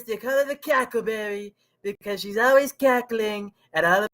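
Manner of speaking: high-pitched, pushed political rally parody; Donald Trump impression. Podcast guest mocking a rival candidate for his co-hosts, Trump impression. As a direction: put on a mocking stump-speech voice, pitched well up. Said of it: "in a Donald Trump impression for you guys".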